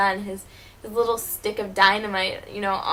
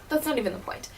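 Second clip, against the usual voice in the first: ordinary talk, nothing marked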